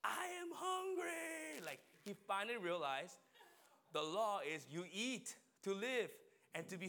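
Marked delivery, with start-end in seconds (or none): 0.0-1.6 put-on very high, slow wailing child's cry; drawn-out, high little-boy sob of hunger; crying hungry kid. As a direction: wail it out like a starving toddler. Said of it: "he was crying. He was like".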